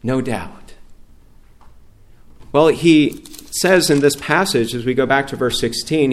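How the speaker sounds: normal talking voice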